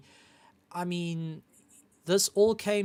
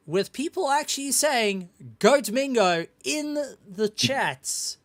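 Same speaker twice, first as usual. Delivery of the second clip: high, excited, slow announcer voice; big hype host introducing the champ; 9 semitones higher, 5 dB louder than usual. Acted. Host hypes the race winner.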